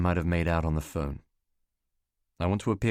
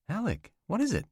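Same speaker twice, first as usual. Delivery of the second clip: higher, concerned woman on the phone; worried woman's voice; 12 semitones higher than usual. Acted. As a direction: sound anxious for him. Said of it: "Catherine worried on phone".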